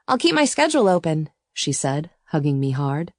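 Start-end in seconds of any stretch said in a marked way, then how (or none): 0.1-1.3 put-on higher, eager girlish voice; young girl voice. A narrator's reading. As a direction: brighten up as the sister.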